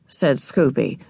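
ordinary delivery

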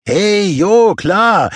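a tipsy lad agreeing casually; lässiger junger Mann. A narrator's reading. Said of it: "entgegnete ein junger Mann". (locker zustimmend)

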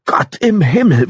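slow, high, strained horror; horrified gasp. A narrator's reading. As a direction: choke it out in shock. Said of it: "wollte Melinda hervorstoßen".

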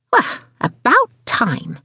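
high, slow, pointed complaint; mildly snappish. A narrator's reading. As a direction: let the irritation show at the delay. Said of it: "Missus Angry snapped".

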